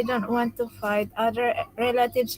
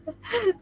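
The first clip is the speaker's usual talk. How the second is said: high laugh; 11 semitones higher than usual. Real, not acted. Laughing at a funny chat.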